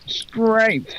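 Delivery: high, effortful, as if the word costs him; straining on strength. Retold or imitated. Imitating a man who strains over every word. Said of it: "he says it like it takes him strength to actually say it".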